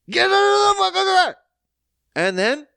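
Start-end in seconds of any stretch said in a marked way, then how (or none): 0.1-1.4 very high, loud retold scream; officer yelling. Officer shouting at the man in the car, loud scream. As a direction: scream at him to get out. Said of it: "She's still trying to reason".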